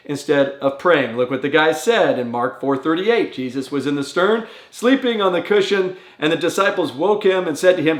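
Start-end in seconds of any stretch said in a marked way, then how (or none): none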